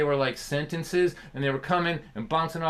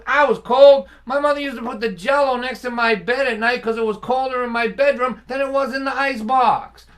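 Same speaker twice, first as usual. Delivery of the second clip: much higher, much louder father's boast; loud retold father; 9 semitones higher, 8 dB louder than usual. Father telling his son about his cold childhood, loud and high. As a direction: belt out the hardship like a proud dad.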